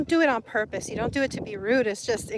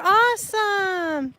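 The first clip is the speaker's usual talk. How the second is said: drawn-out excited cheer; 8 semitones higher, 6 dB louder, much slower than usual. Real, not acted. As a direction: stretch it out, thrilled. Excited reaction to chat news.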